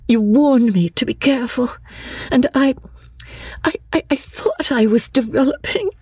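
high, tearful, halting plea; emotional outpouring. A narrator's reading. Lucy confiding in her cousin after the storm, pouring out her soul. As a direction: pour it out high and breathy, close to tears. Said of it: "Lucy poured out hers to her cousin".

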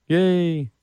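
high, drawn-out mock yay; sarcastic cheer. Real, not acted. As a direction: cheer bitterly at the other team's win. Mock cheer for bitter loss.

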